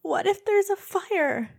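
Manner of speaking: mock small-child worry; imitated little daughter. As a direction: play the fretting little girl. Mom imitates worried young daughter.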